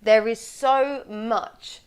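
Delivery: very slow, weighty "so much"; stress on SO MUCH. Shown as a demonstration. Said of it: "quite an easy way to emphasise".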